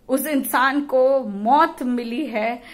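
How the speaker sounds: मौत drawn out, loud and slow; emphatic, slow grief; slow, weighty emphasis. Real, not acted. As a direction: lean hard and slow on मौत. Grief-laden outburst.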